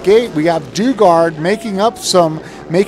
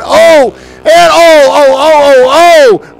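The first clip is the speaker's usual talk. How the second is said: much higher, louder alarmed cries; 8 semitones higher, 9 dB louder, slower than usual. Real, not acted. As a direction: gasp out loud at the crash. Watching a crash happen.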